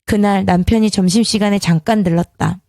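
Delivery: usual speaking voice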